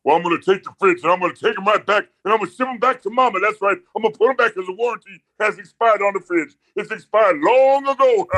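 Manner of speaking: high-pitched hammed-up wrestler rant; wrestling promo. Shown as a demonstration. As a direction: boast and threaten like a wrestler working the camera. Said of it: "do the wrestling thing".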